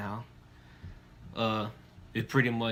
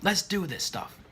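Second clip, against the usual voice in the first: excited; 6 semitones higher than usual. Acted. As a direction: kick it off with energy. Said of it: "sounding excited like he is".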